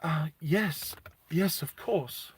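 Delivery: slow, scared whispered yes; barely breathes out a frightened agreement; nervous whisper. Retold or imitated. Nervous mum facing the humanoid.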